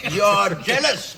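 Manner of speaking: insistent accusation. Acted. Repeats accusation, louder and slower.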